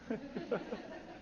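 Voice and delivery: high soft laugh; a quick amused laugh. Real, not acted. Laughs after joke.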